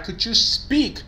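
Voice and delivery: teacher's slow, high "Could you speak"; modelling the right expression. Shown as a demonstration. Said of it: "the right expression is".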